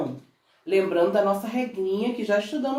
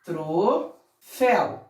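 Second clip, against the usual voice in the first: "Troféu" split into TRO, FÉU; slow "Troféu" with a gap between syllables, lower; separated syllables; 4 semitones lower, much slower than usual. Shown as a demonstration. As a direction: break "Troféu" into two separate beats. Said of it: "Como separamos essa sílaba?".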